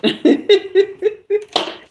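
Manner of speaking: bright high-pitched laugh; high giggle. Real, not acted. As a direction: giggle at herself. Embarrassed after her accent slipped out.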